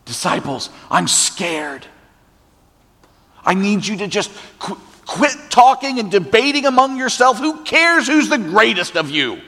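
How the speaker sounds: a frightened Jesus begging his disciples to listen; pleading Jesus. Acted. Preacher voices Jesus pleading.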